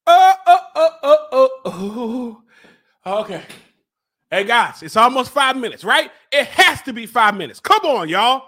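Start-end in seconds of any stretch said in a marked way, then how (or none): 0.1-3.2 string of loud "oh"s, falling away; pained yelps. Real, not acted. Suffering through the challenge, pained yelps. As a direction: yelp repeatedly, then sink into a groan. Groaning in pain.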